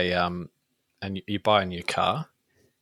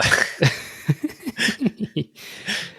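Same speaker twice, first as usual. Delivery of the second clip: long high-pitched giggle; high laugh; 14 semitones higher, 4 dB louder than usual. Real, not acted. Co-host laughs after awkward topic switch.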